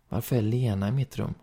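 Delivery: ordinary delivery, nothing marked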